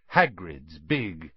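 plain reading voice

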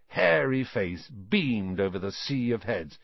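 usual speaking voice

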